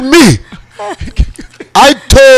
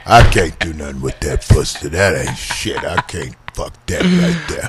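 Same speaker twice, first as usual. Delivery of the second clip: disgusted man; 12 semitones lower, 8 dB quieter than usual. Retold or imitated.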